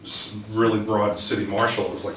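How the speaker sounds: ordinary delivery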